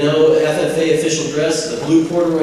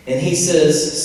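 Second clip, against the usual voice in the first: normal talking voice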